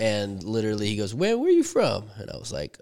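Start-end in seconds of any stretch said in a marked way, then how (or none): 1.1-2.0 lightly voiced southern mover, pitched high; a hint of the questioning mover; imitated mover. Mover asking the host where he's from, imitated mover.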